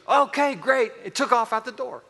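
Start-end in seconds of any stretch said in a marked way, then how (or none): 0.1-0.9 high, bright little boy's reply, lightly voiced; cheerful boy. Father retelling his son's happy answer, mock kid voice. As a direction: toss it off happily like a kid running out. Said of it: "And he goes".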